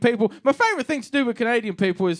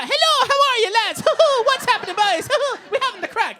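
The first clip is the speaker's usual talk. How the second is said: very high leprechaun squeal; hammed-up high Irish accent with whooping giggles; crazy cartoon Irish dad; 14 semitones higher, 3 dB louder than usual. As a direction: greet the lads like a cartoon Irishman. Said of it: "an impression of my dad".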